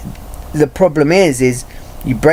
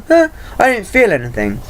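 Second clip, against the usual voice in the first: mock indifference; 7 semitones higher than usual. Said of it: "they'll go".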